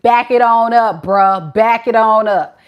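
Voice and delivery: loud back-off warning; voicing Shay. Reader voicing Shay warning the man off, loud. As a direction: push him away, loud and firm. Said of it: "operating out of a Queen of Swords energy, like".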